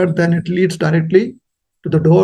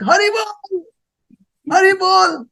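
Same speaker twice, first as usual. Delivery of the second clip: much higher ecstatic call; joyful exclamation; 11 semitones higher than usual. Retold or imitated. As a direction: cry it out in rapturous delight.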